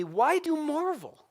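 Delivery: high, slow, drawn-out angel voice; a lifted, slowed voice for the angel; astonished angel. Acted. Angel questions the staring John.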